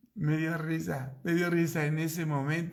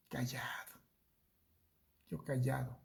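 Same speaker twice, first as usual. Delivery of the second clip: ordinary talk, nothing marked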